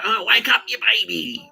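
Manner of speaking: high, loud taunting sing-song; mocking taunt. Taunting sleepy housemates to wake up, high mocking voice. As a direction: jeer at them like they're babies.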